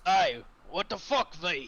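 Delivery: high, loud protest; outraged in-character shout. Acted. Stinksack confronts V over the killed prisoner, shouting. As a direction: call V out, loudly indignant.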